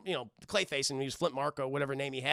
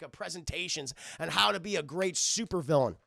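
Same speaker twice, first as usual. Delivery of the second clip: normal talking voice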